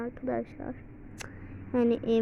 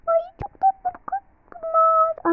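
cooing; 6 dB louder than usual. Real, not acted. Cooing over cute items.

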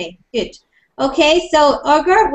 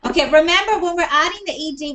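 normal talking voice

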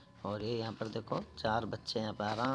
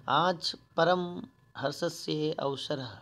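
slow, louder recited reading; Sanskrit recitation; 4 semitones higher, 5 dB louder, slower than usual. Shown as a demonstration. Teacher reading the organiser's Sanskrit line to pupils, louder. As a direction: declaim the line like a text reading. Reads Sanskrit phrase aloud.

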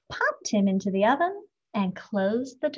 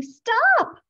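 high, loud shout after him; 10 semitones higher, 7 dB louder than usual. A narrator's reading. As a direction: yell it down the road.

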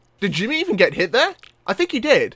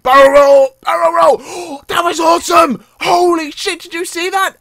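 high, loud, exhilarated cheer; thrilled shouting; 9 semitones higher, 6 dB louder than usual. Real, not acted. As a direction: yell as the stunt comes off. Pulls off stunt.

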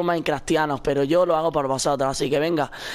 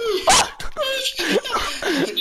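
high laugh. Real, not acted. Laughs after being hit in game.

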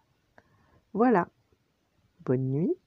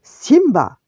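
loud, stern father's shout; thundering; 11 semitones higher, 12 dB louder than usual. A narrator's reading. Furious Mufasa addressing his son, thundering. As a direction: boom the name out in anger. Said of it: "tonna Mufasa".

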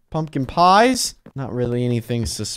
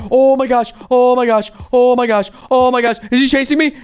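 ordinary talk

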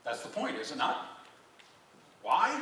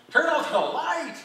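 high, loud, drawn-out yell; hammed-up shout at the light; 11 semitones higher, 9 dB louder than usual. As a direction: yell it slowly in mock agony. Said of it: "They start shouting at you".

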